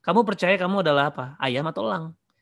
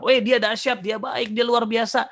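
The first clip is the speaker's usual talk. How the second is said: higher, louder excited hype; gushing praise; 8 semitones higher, 3 dB louder than usual. Acted. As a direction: rave about someone amazing. Voices someone gushing about a person.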